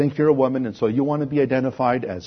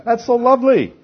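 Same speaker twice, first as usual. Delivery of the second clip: high, loud sneering praise; mock the crowd with sugary scorn; sarcastic mockery; 10 semitones higher, 6 dB louder than usual. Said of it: "And people laugh at that".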